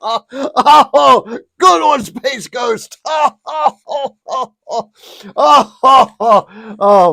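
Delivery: loud, high laughing exclamation; laughing hard. Real, not acted. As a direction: shout it through helpless laughter. Cracking up at the note.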